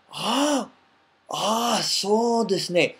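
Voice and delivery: high gasp, then a drawn-out so desu ne; amazed gasp. Acted. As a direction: act stunned by the news. Acting a Japanese listener reacting.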